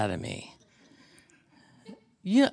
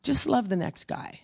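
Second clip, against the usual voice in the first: gentle advice; 10 semitones higher than usual. Retold or imitated. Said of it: "you know, said".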